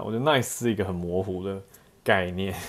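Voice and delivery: usual speaking voice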